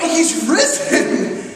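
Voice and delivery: high, drawn-out excited cry; excited disciples. Retold or imitated. Disciples insisting to doubting Thomas, excited and emphatic. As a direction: stretch it out high with giddy insistence. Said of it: "they just were excited and they were emphatic".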